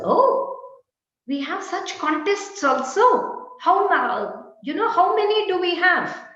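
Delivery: "Oh" jumps high, then a surprised eager question; mock surprise. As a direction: play the puzzled listener, amused. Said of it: "the other person wonders".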